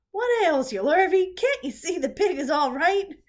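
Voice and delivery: soaked farmer scolding the hired man; exasperated shouting. A narrator's reading. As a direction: scold him loudly, half laughing. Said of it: "bellowed Mister Zuckerman".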